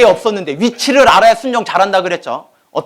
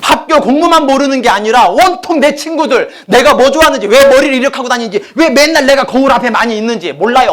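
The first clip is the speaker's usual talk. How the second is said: higher and louder, pressing the point home; fervent preaching; 7 semitones higher, 6 dB louder than usual. Acted. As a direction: preach it to the congregation. Preacher builds to full force. (높고 힘차게 설교하듯)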